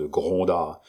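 usual speaking voice

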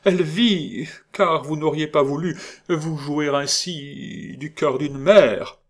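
high, emotional mother's plea; overwhelmed pleading. A narrator's reading. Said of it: "balbutia-t-elle".

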